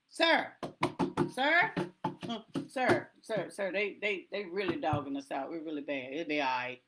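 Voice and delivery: soft, sinking pleading voice; timid deacon. Retold or imitated. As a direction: plead with the pastor, losing nerve. Imitating deacon reporting to pastor.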